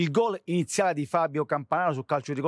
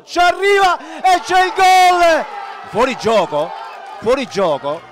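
excited goal call; 3 semitones higher, 9 dB louder, slower than usual. Acted. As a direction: shout the goal, then offside. Goal called then ruled offside.

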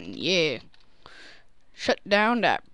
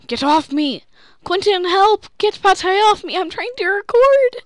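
high, loud frantic shouting; shouting for help; 11 semitones higher, 9 dB louder than usual. Real, not acted. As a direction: yell for help, exasperated. Cat on him, calling for help.

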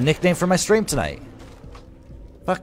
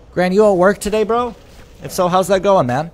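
louder, higher call to a chatter; 6 semitones higher, 6 dB louder than usual. Real, not acted. Streamer calls out to Gran in chat, loud friendly call. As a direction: hail him across the room.

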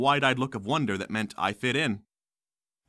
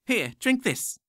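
put-on high, cackly old crone; shrill old lady offering a drink; high old nurse voice; 11 semitones higher, slower than usual. Deranged old nurse speaks.

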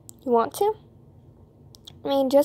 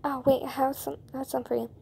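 usual speaking voice

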